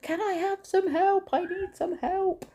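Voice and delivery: calling out high for help; retold shout for help. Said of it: "I'm shouting".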